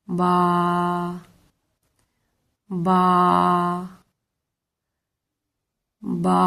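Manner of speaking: با held one alif long; lengthened ba. Shown as a demonstration. Teacher shows the long vowel in با. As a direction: stretch the vowel of با. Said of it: "اتنا ہی با کو ادا کرنے میں ٹائم".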